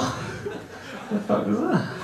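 slow, quiet words broken up by laughter; laughing disbelief. Real, not acted. To the audience, recalling his mate's weird voice, laughing quietly. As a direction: laugh through the question in disbelief.

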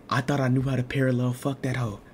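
ordinary talk, nothing marked

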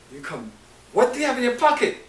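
mother's sharp, high questioning, fast; high, quick scolding mother; stern mother. Retold or imitated. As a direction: snap the question like a strict mother.

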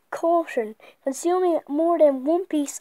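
loud warning read. Shown as a demonstration. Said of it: "let's read the packet again".

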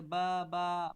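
"বা, বা" loud, each syllable held long; babbling demonstration. Shown as a demonstration. Teacher showing how baby babbles, "বা, বা" loud and drawn out. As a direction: sound out "বা, বা" loudly and evenly. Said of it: "এরকম করে".

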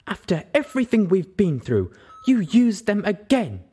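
high, loud, slowing reproach; cross. Acted. Velvet Remedy scolds Little Pip for using again, loud and cross. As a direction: scold her for betraying trust. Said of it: "her voice was cross".

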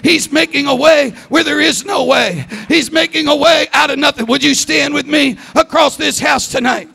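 loud, driving preacher's cry; preaching at full force. Real, not acted. Preacher rallying the congregation, at full force. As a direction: lift the whole house with the prophecy.

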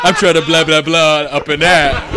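hammed-up joke vampire. Riffing on vampire orgy for his friends, loud and hammy.

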